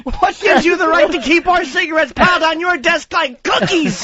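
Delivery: mock inmate rant. Acting out mental ward scene.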